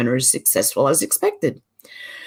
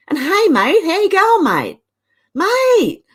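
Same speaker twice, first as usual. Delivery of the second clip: excited; 11 semitones higher, 5 dB louder, slower than usual. Real, not acted. Excited greeting to a viewer.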